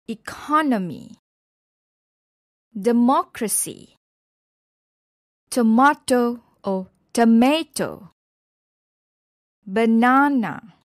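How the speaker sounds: eCONomy, deMOCracy, toMAto, baNAna, second beat punched; stress on second syllable. Shown as a demonstration. Teacher giving examples, second syllables stressed in "economy" to "banana". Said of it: "with the stress on the second syllable".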